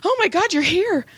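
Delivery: high, frantic mock relief; hyperventilating client impression. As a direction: mimic an overwrought, panting client. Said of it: "She's almost hyperventilating".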